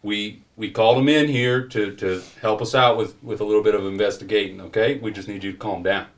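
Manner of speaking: lightly acted officer calming a man down; police officer interjecting. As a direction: step in as a mildly insistent officer. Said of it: "Earl, the police officer".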